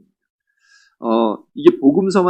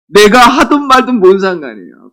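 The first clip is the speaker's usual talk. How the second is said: raised, louder mock protest; indignant retort; 5 semitones higher, 5 dB louder than usual. Spender rebuffing disciples who meddle, indignantly. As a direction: tell meddlers off in acted annoyance.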